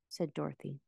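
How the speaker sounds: usual speaking voice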